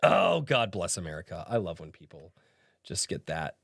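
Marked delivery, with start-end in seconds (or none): none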